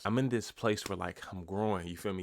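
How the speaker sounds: ordinary talk, nothing marked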